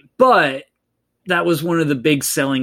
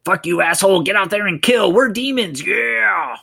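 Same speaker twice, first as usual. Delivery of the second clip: high-pitched mock demon rant; super aggressive; 7 semitones higher than usual. Evil caller ordering Hoax to kill, mock aggressive. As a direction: climb higher into a hammed-up demonic order. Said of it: "he goes super aggressive".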